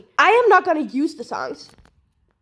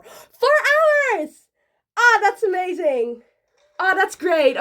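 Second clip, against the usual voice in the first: delighted, louder and higher exclamations; excited; 5 semitones higher, 5 dB louder than usual. Real, not acted. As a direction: react with real thrilled surprise. Wheel gives her four hours.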